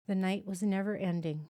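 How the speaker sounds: plain reading voice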